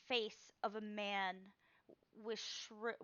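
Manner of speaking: ordinary talk, nothing marked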